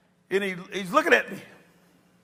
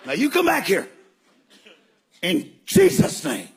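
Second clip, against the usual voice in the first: commanding. Retold or imitated. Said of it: "watching me".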